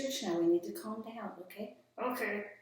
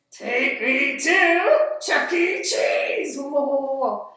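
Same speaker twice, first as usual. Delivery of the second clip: high, much louder, slow sung shout; silly puppet shout; 5 semitones higher, 12 dB louder, slower than usual. Acted. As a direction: belt the silly last line like an excited puppet.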